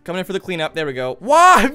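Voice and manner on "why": loud, high repeated wail; anguished shouting. Real, not acted. Player protesting an attack, loud anguished wail. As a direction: cry out in protest each time.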